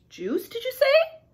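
very high, eager question; storybook boy pouncing on news; crying out. A narrator's reading. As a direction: stretch it with delighted surprise. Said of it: "cried Larch".

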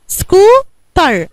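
"سكوتر" slowly, in two separated beats: scoo, ter; split into syllables. Shown as a demonstration. Teacher repeats for pupil, "سكوتر" syllable by syllable. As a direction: stretch each half of "سكوتر" with a gap between. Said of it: "قسمتيها على كام سيلابل".

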